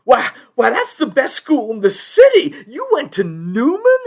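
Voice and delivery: loud, high-pitched incredulity; astonished judge. Acted. Judge learns the lawyer went to Newman, astonished. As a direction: sputter in amazed delight at the news. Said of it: "it's like a pistol shot went off".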